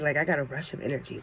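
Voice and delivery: ordinary talk, nothing marked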